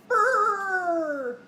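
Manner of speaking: hammed-up cold shiver; mock shiver brrr.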